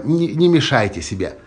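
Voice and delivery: usual speaking voice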